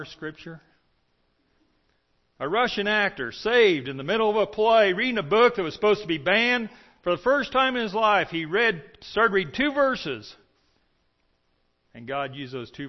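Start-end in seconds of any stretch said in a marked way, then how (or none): 2.4-10.3 higher, louder fervent preacher; preaching at full force. Acted. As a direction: drive the point home to the congregation.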